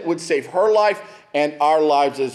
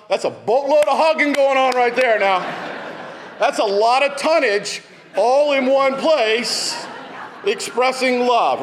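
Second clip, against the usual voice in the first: higher and louder, slow, booming with amusement; hearty big-guy joke; 7 semitones higher, 3 dB louder than usual. Real, not acted. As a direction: ham up the big bear hug, laughing. Joking about big guys hugging.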